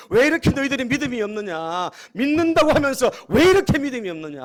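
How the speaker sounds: loud, high, acted angry scolding; indignant shout, building in force; angry rebuke. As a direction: thunder at faithless followers as a preacher voicing anger. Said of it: "예수님이 뭐 화를 내셨다는 거예요".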